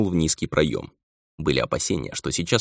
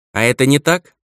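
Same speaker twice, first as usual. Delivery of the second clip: defiant; 7 semitones higher, 5 dB louder than usual. A narrator's reading. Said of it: "с вызовом бросила ведьма".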